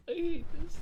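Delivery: squealed high and loud; embarrassed yelp. Real, not acted. Embarrassed at her own voice test, squealing loudly. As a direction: cry it out, mortified. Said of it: "embarrassed at her own reading".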